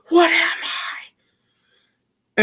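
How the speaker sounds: slow, breathy high whisper; eerie whisper. Retold or imitated. Boy whispering to his mother, slow and eerie. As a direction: whisper it slowly like a frightened possessed child.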